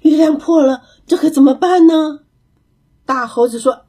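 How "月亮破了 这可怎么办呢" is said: anxious. A narrator's reading. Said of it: "着急地说".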